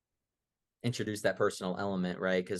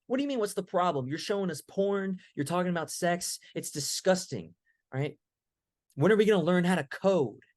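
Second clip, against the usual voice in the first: higher pitched, fast indignant demand; exasperated student; 6 semitones higher than usual. Retold or imitated. Student demanding coding lessons, exasperated higher voice. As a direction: push back hard, fed up.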